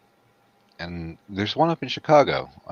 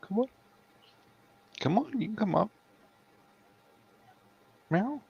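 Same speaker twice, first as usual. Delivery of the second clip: high coaxing voice; 4 dB quieter than usual. Real, not acted. Coaxing the cat.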